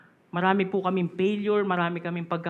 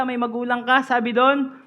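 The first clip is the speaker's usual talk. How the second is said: ordinary talk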